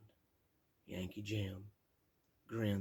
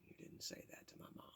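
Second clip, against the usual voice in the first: fast soft unvoiced aside; whispered aside; 17 dB quieter, faster than usual. Real, not acted. Aside to listener about mom: quick whisper. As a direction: hiss it quickly under breath.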